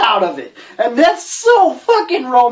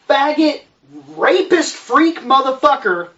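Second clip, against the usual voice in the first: ordinary talk, nothing marked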